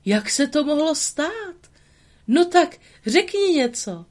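high, excited disbelieving plea; amazed old man. Acted. Geppetto, astonished, urges the living puppet to speak. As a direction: urge the puppet to speak, beside yourself.

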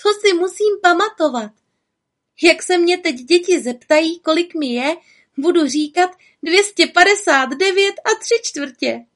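put-on high excited masked child; child in witch mask. A narrator's reading. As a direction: play it like a thrilled kid.

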